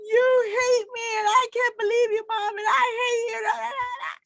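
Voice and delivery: hammed-up, very high wailing tirade; emotional teenage rant. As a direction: imitate a daughter's overwrought, screechy outburst. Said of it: "just a rant of, ah, emotion".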